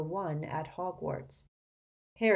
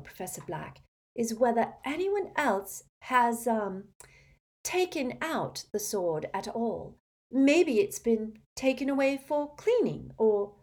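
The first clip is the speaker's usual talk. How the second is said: higher-pitched, hesitant questioning; high, faltering schoolgirl inquiry; earnest girl student, tentative; 7 semitones higher, 4 dB louder than usual. Acted. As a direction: feel your way politely towards the question. Voices Hermione questioning.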